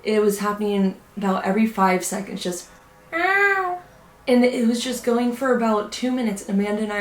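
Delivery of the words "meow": very high, stretched "meow" like a cat; imitated loud meow. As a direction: copy the creepy cat cry, pitched way up. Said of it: "this loud meowing noise".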